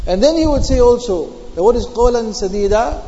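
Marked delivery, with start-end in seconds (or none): none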